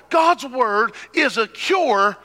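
high, slow, shouted climax; preaching shout. Acted. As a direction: drag it out and shout it.

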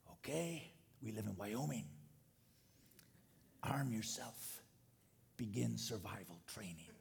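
low, hushed and terse survival orders; mock survivalist. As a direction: play a survivalist giving grim orders under the breath. Acts out a Wyoming survivalist.

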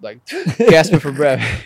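sharp gasp for breath; imitating a gasp. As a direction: gasp like waking up startled. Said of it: "That's how she always wake up".

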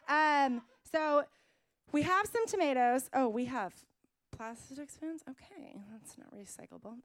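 ordinary talk

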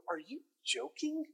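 high, hushed incredulous wife; quoting his wife's amused disbelief; wife's mock disbelief. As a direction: tease him like he can't be serious. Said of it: "she would go".

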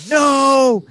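high, loud drawn-out wail of protest; mock-despairing shout; dismayed cry. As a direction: re-enact a group crying out in dismay. Retold group cry as lights go off.

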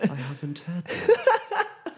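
high, slow words through laughter; amused laughing. Acted. Mage hears new slang from the troll, giggling. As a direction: giggle through it, tickled by the phrase.

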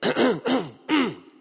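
loud hinting throat clear; pointed throat clearing. Retold or imitated. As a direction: clear your throat to drop a hint. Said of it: "she starts to go".

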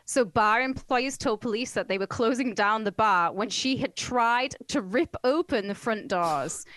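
ordinary delivery

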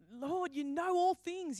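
earnest protest. Retold or imitated. Peter's answer the third time.

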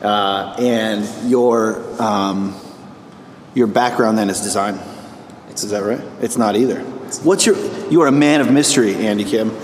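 normal talking voice

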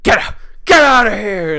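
hammed-up furious dad throwing him out; mock shout. Father throws son out of the house, mock yell. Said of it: "he's like".

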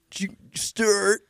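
slow, high, exaggerated California accent; The Californians. Host imitating a comedy skit giving directions, drawn-out California accent. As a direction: drawl it as a spoof California accent. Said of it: "the Californians from, uh, S N L".

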